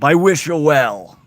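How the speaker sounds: slow, drawn-out mock politician voice; slow, emphatic parroted well-wishing; Trump. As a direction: stretch each word out, loud and deliberate. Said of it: "You're like Trump".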